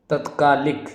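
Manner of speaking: normal talking voice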